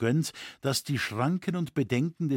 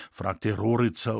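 plain reading voice

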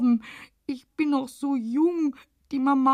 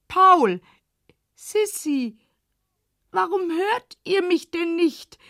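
calling out; 3 semitones higher, 5 dB louder than usual. Acted. Calling out to Paul.

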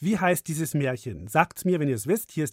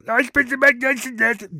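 mocking imitation; 8 semitones higher, 6 dB louder than usual. Host mocks co-host's self-praise.

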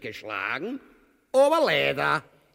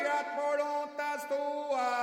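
Gebet singen; 11 semitones higher, much slower than usual. Acted. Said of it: "bete ich gerade ein Paternoster".

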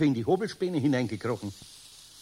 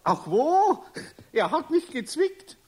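lachend; 12 semitones higher, 3 dB louder, slower than usual. Acted. Eder lacht verlegen nach Zwicken.

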